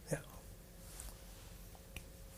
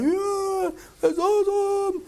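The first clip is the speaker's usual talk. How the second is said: much higher, mock-thrilled "Yeah, that's awesome"; excited reaction; 13 semitones higher, slower than usual. Preacher imitates a church hearing of mass salvation, mock-thrilled and high. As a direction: squeal it like an overjoyed churchgoer. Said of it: "we'd be like".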